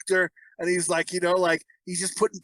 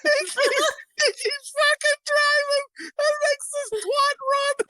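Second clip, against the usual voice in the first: high, giddy voice breaking with laughter; laughing happily. Real, not acted. Retelling the motorcycle scene to friends, helpless laughter. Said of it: "how happy I sound right now".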